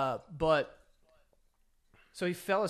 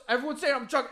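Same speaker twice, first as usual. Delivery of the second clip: higher, louder mock protest; retold indignant yelling; 6 semitones higher, 5 dB louder, faster than usual. Friend denying he was drunk, retold as indignant yelling. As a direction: imitate a defensive, mildly yelled denial. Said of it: "he was getting mad at us and yelling".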